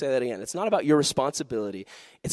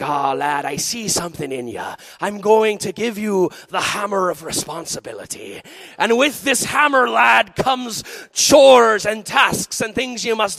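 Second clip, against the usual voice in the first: Irish brogue building to a loud, high proclamation; slow, grand mock Irish shout; mean old Irish captain; 5 semitones higher, 6 dB louder, slower than usual. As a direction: declaim like a hammy Irish pirate captain. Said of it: "she looked at me and she's like".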